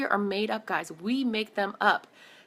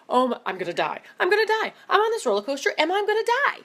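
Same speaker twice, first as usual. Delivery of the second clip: rising into high panicked cries; mock terror; 8 semitones higher, faster than usual. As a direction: act out dread at the top. Imagined terror on roller coaster.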